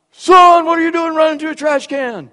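sudden loud, high mock yell; drill sergeant yell. Upperclassman ambushing a cadet at the trash can, loud yell.

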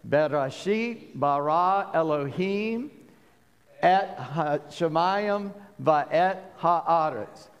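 Bereshit bara Elohim et Hashemayim va'et ha'aretz said slowly as one line; slow careful recitation. Shown as a demonstration. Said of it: "let's try to say it all together".

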